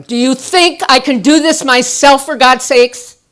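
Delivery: loud, high furious yell; screaming at him. Retold or imitated. Wife screaming at husband in the kitchen, furious yell. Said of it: "started screaming at him".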